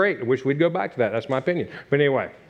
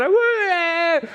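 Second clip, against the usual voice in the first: a high, whooping woo from a passing car; friends' mock yell; 12 semitones higher than usual. Friends drive by yelling at him, high whoop. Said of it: "blew the horn and yelled".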